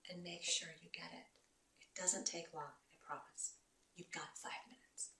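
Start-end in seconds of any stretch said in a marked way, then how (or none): 2.6-5.1 whispered promise. Real, not acted. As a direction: drop to a soft whisper. Closing reassurance, hushed.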